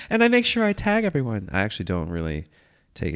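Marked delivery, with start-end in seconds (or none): 0.1-1.5 very high, loud put-on voice; hammed-up high Instagram voice; mock Instagram poster. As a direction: squeal it like a parody of a social media poster. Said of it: "just like on Instagram".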